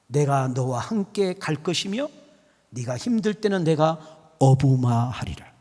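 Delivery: low, slow divine promise; the Lord's reassurance. Acted. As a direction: answer gently as the Lord, leaving long pauses. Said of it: "우리 주님이 우리에게 쏙 찾아서 물어요".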